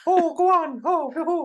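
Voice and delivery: very high, loud squeaky Irish leprechaun; mock Irish leprechaun. Leprechaun flirting with the fortune teller, mock Irish squeak. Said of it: "Kiss me, Clover".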